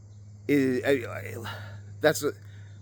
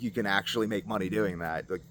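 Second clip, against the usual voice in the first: usual speaking voice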